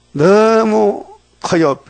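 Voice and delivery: 너무 커요 said very slowly and high, stretched out; drawn-out emphasis. Real, not acted. Preacher stretching out 너무 커요 with slow awe to the congregation. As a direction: draw out 너무 커요 with awe. Preacher stresses God's great love.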